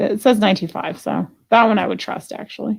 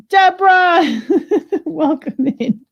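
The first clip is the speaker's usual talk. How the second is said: high, laughing hello; bright laughing welcome to a viewer; laughing greeting; 9 semitones higher, 4 dB louder than usual. Real, not acted.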